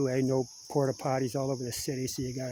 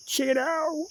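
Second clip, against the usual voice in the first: morning voice cracking up high; 4 dB louder, slower than usual. Real, not acted. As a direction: let the voice crack on waking. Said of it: "There's that early morning voice".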